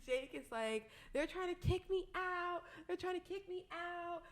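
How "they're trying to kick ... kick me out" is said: high-pitched mimicked protest; complaining friend imitation. Retelling friend's plea as he gets kicked out, high mock protest.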